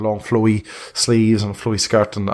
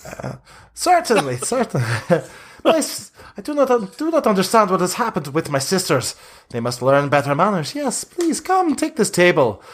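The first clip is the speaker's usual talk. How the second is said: flattered Vistani leader; 7 semitones higher than usual. Acted.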